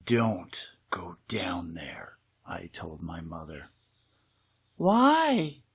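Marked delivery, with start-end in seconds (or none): 0.0-2.2 slow, breathless warning whisper; urgent whisper. Acted. Warning mother about cellar. 4.8-5.6 mother asking. Acted. Mother voiced in story.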